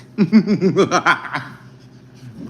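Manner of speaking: high, loud wavering joke laugh; goofy laugh. Pretend. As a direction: laugh loudly in a high, rippling trill. Joking about a K-pop dance.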